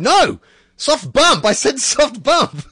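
high, loud mock-outraged yell; indignant protest. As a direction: protest it loudly, high-pitched, stretching the last words. Acting out player protesting to umpire.

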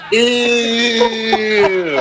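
long, drawn-out high "ew"; kids' grossed-out chorus. Retold or imitated. Kids on the school bus seeing it, long disgusted squeal. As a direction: imitate a busload of disgusted kids. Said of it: "all you hear the kids on the bus going".

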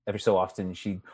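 normal talking voice

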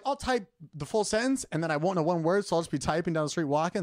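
ordinary talk

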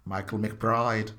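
quiet, slow mock Irish accent; passable Irish accent. Co-host mimicking Michael's quiet bad accent, soft put-on Irish. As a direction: murmur it low in an imitated Irish accent. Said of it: "[name]'s accent just now was passable".

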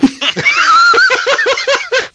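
high shrieking laugh; loud burst of laughter. Real, not acted. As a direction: cackle out loud. Group laughing at a joke.

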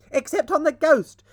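high, loud gleeful shriek. A narrator's reading. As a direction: shriek it as a cheeky joke. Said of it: "shrieked the twins".

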